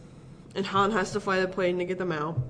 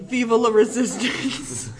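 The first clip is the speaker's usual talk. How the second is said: drawn-out, raised rallying cry; mock yelling; 4 semitones higher, 6 dB louder, slower than usual. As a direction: imitate a rebel shouting a slogan. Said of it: "yelling, like".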